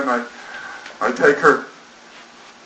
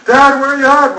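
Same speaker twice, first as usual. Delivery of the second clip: calling out; 13 semitones higher, 7 dB louder than usual. Retold or imitated. Daughter calling through the house.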